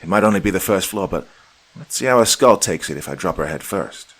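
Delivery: threatening warning. A narrator's reading. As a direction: threaten hard and quick. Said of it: "he warned".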